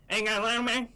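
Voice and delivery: mild impression of a guy asking for a lighter; imitating the guy. Podcaster mimics a stranger asking for a lighter, lightly voiced. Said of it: "That's exactly what he fucking sounded like".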